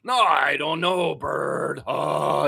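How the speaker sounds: worried, depressed Muppet voice; hesitant monster moaning with dread; Telly Monster. Acted. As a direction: groan and fret like an anxious puppet. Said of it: "He's like Telly Monster".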